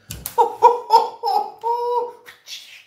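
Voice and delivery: high squealing belly laugh; shrieking laugh. Real, not acted. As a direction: let out a big wheezing cackle. Big laugh after punchline.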